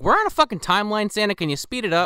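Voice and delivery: impatient lawyer. Retold or imitated. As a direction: rush him along.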